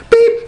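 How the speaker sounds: high squeaky "Beep!" like a checkout scanner; sharp electronic beep. Retold or imitated. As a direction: chirp it out like the machine. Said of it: "and it goes".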